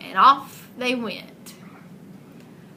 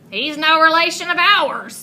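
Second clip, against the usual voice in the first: frogs declaring; 5 semitones higher, 7 dB louder than usual. Acted. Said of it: "declared the frogs".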